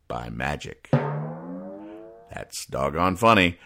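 ordinary delivery

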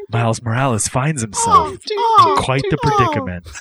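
dramatic trailer read; trailer narrator. Pretend. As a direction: voice over a movie trailer. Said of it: "you're narrator".

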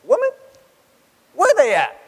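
brash mock yell, high and loud; "Where they at?" shouted high and loud; wrong blunt delivery. Shown as a demonstration. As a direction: fling it out rudely, the way it shouldn't sound. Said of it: "No. That's not the feel here".

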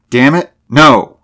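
loud, slow, emphatic outburst; exclaiming. A narrator's reading. Driver refuses to give up before armed group, exclaiming. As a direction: burst out in frustrated refusal. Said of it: "he exclaimed".

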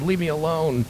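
soft, sleepy brush-off; grumbling Jonah. Retold or imitated. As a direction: mumble it from under a blanket.

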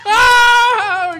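high, loud startled oh; surprised shout. Real, not acted. Reacting to the guess.

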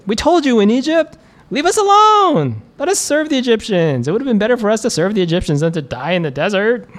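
high, panicked voice of the people; terrified complaining Israelites. Acted. Terrified Israelites crying out to Moses, high and frantic. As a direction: plead and accuse Moses in rising panic. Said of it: "The Israelites were terrified".

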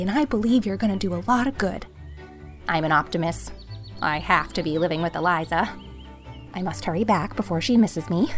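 mildly breathless confiding; out of breath. A narrator's reading. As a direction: confide hurriedly, a touch winded from running. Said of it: "she was so out of breath".

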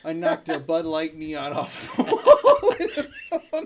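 loud, slow, breaking into laughter; laughing. Real, not acted. Host confessing his mishap to cohost, laughing. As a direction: crack up on the punchline. Cracks up telling own mishap.

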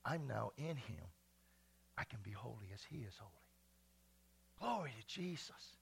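ordinary talk, nothing marked

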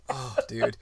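ordinary delivery

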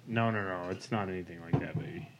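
ordinary talk

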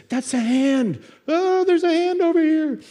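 put-on high-pitched squealing child; loud, very high little-kid excitement; excited kid. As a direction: shout it like a kid making a thrilling discovery. Child's excited discovery in retold anecdote.

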